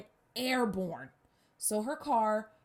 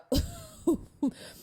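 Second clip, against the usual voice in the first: high laugh. Real, not acted. Laugh mid-story.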